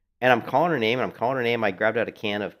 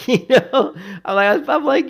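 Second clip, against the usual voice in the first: laughing; 10 dB louder, slower than usual. Real, not acted. Laughing while retelling the cat.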